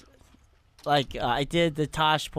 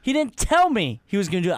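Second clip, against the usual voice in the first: normal talking voice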